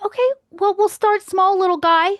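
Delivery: high-pitched humouring tone; talking down. Acted. Boss soothing a reluctant little helper, talking down. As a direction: humour them like a small child.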